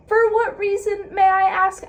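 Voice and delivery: high, loud outraged question; ranting at the author, high and loud; indignant demand. Real, not acted. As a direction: demand an answer, furious. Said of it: "I'm so upset".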